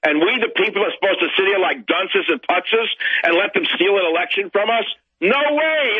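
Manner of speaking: ordinary delivery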